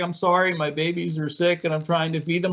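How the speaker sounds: imitating a stressed mom apologising over crying babies; apologetic frazzled mother. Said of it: "acting like she is this ... beaten housewife".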